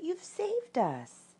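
very high, slow, soft exclamation; Piglet's cry. A narrator's reading. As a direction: draw it out in a small high voice. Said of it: "cried Piglet".

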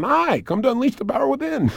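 a hint of a bright promo host inviting the audience; cheery trailer greeting. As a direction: play his own grinning ad voice. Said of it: "there's my smiling face going".

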